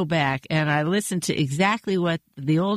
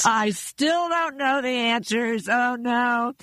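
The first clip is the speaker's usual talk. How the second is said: higher, slow, mock-panicked wail; mock exam panic; 6 semitones higher, slower than usual. Recalling exam nightmares to the host, higher mock panic. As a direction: play up the dread of a forgotten test. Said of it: "I break out in a sweat".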